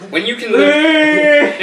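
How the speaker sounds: high, loud, slow laughing mentor voice; hammed-up laughing sage; grand mock mentor. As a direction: play a solemn sword master, cracking up. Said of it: "gives a baby a sword".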